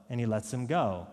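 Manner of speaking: usual speaking voice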